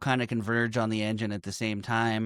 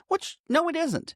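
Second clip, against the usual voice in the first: normal talking voice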